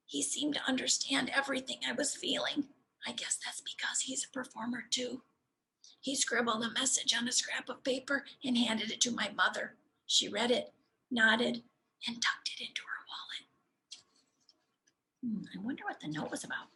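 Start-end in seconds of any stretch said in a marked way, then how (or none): 3.0-5.2 lost voice, whispering every word; breathy whisper with no voice left. Real, not acted. Boy who woke up voiceless whispers his diary entry. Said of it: "The loudest sound I can make is a whisper". 12.1-13.5 lost voice, whispering every word; breathy whisper with no voice left. Real, not acted. Boy who woke up voiceless whispers his diary entry. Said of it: "The loudest sound I can make is a whisper".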